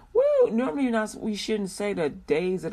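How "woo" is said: high, loud woo; short high cheer; excited whoop. Real, not acted. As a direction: let out a celebratory whoop. Cheers after announcing the day.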